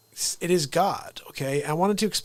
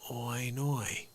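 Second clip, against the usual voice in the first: 1 mock Australian accent; 3 semitones lower, 10 dB quieter than usual. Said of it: "you're saying".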